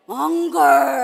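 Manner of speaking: loud, forceful anger; angry word. Shown as a demonstration. Teacher shows anger to the class, loud and forceful. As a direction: punch it out angrily. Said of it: "let's say it with emotion".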